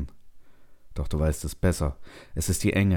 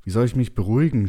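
higher, indignant outcry; agitated cry; 5 semitones higher, 4 dB louder, faster than usual. A narrator's reading. Settler cries into the darkness at the voice, agitated. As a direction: cry out in disbelief at being told to calm down. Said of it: "schreist du in die Dunkelheit".

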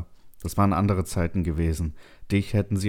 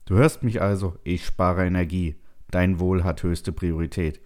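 plain reading voice

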